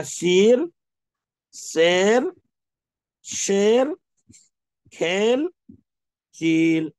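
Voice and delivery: شیر، سیر، کھیل، چیل with an open e; teacher calling out majhool words for the class; ya majhool drill. Shown as a demonstration. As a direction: call out each word firmly like a teacher. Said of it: "پھر س-، کیا؟".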